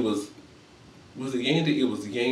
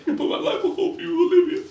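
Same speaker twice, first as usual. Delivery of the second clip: much higher, laughing fake sob; mock crying voice. Shown as a demonstration. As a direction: wail it out like a hammed-up crybaby. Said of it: "I gotta do it in my crime voice".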